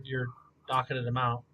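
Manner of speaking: ordinary talk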